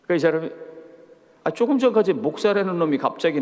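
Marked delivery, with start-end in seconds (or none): none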